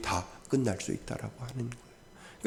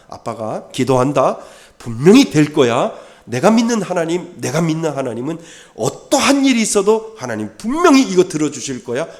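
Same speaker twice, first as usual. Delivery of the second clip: louder, higher, fervent promise; fervent vow; 6 semitones higher, 9 dB louder than usual. Retold or imitated. Parent vowing to the child, louder and fervent. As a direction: declare it with burning conviction.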